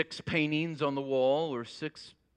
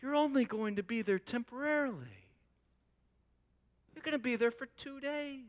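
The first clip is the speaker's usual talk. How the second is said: higher-pitched, disbelieving reproach; mild incredulity; 5 semitones higher than usual. Acted. Friend challenges a man overinvesting in a two-day trip, incredulous. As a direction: question his priorities in mild disbelief.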